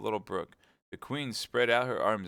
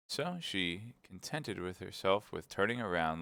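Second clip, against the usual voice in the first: usual speaking voice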